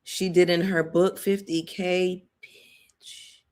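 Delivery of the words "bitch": barely audible, voiceless whisper; whispered aside. Real, not acted. Vlogger reacting to a fifty K figure, whispered. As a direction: whisper it under your breath.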